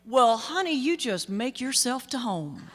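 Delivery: Dad's big Southern drawl, slow at the end; thick Southern accent. Retold or imitated. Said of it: "in a thick Southern accent say".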